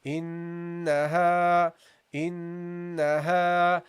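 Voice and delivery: "Innaha" very slow, long nasal n and light ha; slow drawn-out recitation. Shown as a demonstration. Teacher recites the word slowly for the class. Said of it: "That's how you pronounce it".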